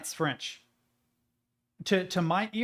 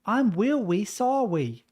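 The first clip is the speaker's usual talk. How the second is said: put-on a light childish uwu voice, higher, R turned into W; 6 semitones higher, 3 dB louder, slower than usual. Shown as a demonstration. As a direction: lisp the R sounds like a cute uwu. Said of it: "the uwu voice".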